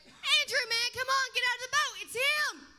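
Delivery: loud, high call across the water. Acted. Peter calls Andrew out of the boat, loud and high. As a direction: yell to your friend to jump out.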